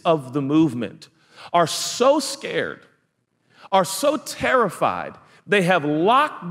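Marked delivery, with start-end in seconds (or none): 1.5-5.2 slow, leaning on so; stretched so. Acted. Preacher painting the disciples' fear, slow stretched so. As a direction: draw the so out long.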